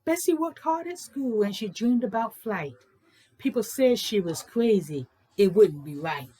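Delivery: lilting, sing-song read-aloud voice; bouncy rhythmic picture-book reading; storybook narration. A narrator's reading. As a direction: read it like a rhyme to children. Reads a rhyming picture book.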